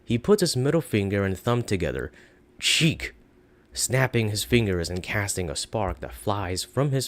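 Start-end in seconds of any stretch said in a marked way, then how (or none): 2.6-3.1 high, quick spell word snapped out; sharp spell cry. Acted. Mage casting a spell at the guards, high sharp cry. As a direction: fire off the spell with a snap.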